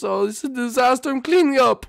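high, alarmed cartoon plumber; high frantic Mario. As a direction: pitch jumped way up, flustered. Mario frantic over mess.

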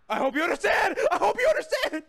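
high, loud mock-desperate yelling; hysterical plea. Real, not acted. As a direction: wail it loudly through laughter. Mock plea to opponent while fighting.

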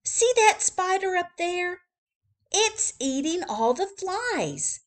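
high, loud excited discovery; excited shout. Acted. Joe shouting his discovery to the chief, high and loud. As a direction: shout out the big find. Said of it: "Joe shouted".

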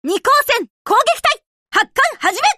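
high, urgent launch order; commanding call to the pilots; battle command. Acted. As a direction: send the squadron off with authority.

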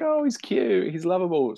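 high cooing over a cute dog; gushing fans. Retold or imitated. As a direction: coo like you've seen a puppy. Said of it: "everybody's like".

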